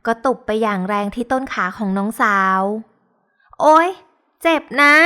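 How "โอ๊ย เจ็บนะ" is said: sudden loud yelp after a slap; yelp of pain. Acted. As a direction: cry out, stung and startled. Slapped hard on the thigh. (ร้องโอ๊ยเสียงดังเพราะเจ็บ)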